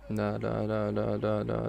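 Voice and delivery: low, soft string of no's; anxious muttering. Real, not acted. Fan watching danger approach, anxious low murmuring. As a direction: murmur no under your breath.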